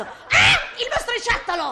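hammed-up horror at the boy; mock shriek. Countess spotting the boy, in a hammed-up shriek.

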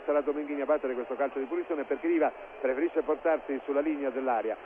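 ordinary talk

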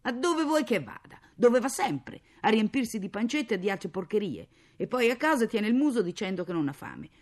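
loud, high-pitched irritated outburst; angry. Acted. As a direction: rant bitterly about a useless husband. Angry mother ranting about absent husband. (sfogo arrabbiato)

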